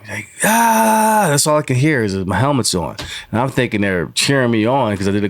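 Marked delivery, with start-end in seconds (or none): 0.4-1.3 high, loud "Ah!" yell; imitated coach's scream. Retelling how the coach screamed at him, imitated scream. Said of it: "was screaming".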